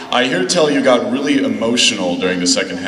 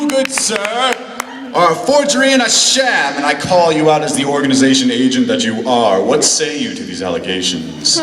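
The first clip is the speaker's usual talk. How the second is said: high theatrical accusation; mad scientist; 5 semitones higher than usual. Acted. Fan in character accuses.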